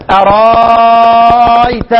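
أرأيت with the vowel held very long, slowly; long madd. Shown as a demonstration. Teacher recites Warsh's extra reading with long madd. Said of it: "ورش يزيد".

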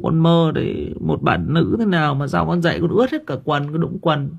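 put-on a boy whining about pimples and dreams; anxious teen boy. Teen boy confessing worries to mother. (cậu con trai than thở với mẹ, giọng cao hơn)

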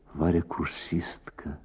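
plain reading voice

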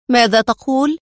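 mildly outraged rising question; indignant. A narrator's reading. Mona reproaching the man who flattered her, indignant. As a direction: demand it with a touch of outrage. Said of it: "وهي تقول ساخطة".

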